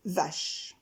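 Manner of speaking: Vache said soft and low as one beat; syllable demo. Shown as a demonstration. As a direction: mark Vache as a single arc. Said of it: "une syllabe, un arc de cercle".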